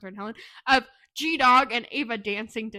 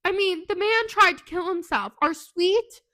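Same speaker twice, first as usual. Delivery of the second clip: worked-up, higher and louder protest; indignant rant; 5 semitones higher, 5 dB louder than usual. Real, not acted. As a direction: rant in laughing outrage, pitched up. Mock-upset rant, laughing listeners.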